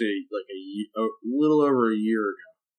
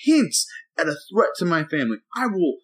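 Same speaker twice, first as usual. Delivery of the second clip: retold heated rant; 6 semitones higher than usual. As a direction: spell out every move, worked up. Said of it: "I'm telling her everything I'm doing".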